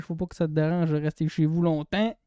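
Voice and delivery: ordinary talk, nothing marked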